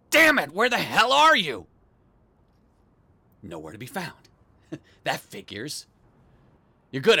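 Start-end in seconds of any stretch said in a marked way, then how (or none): 0.1-1.7 loud, high burst of frustration; exasperated shout. Acted. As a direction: shout at the hidden pests in exasperation. Frustrated search for hidden pests.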